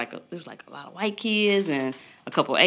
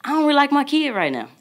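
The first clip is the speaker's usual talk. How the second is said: exasperated; 8 semitones higher, faster than usual. Retold or imitated. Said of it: "the first thing I said was".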